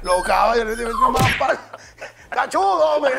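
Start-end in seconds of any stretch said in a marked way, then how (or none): 1.1-3.1 high, loud comic outburst; retold yelling. Telling how mounted police beat his friend, retold yelling. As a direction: yell the insult over the scuffle.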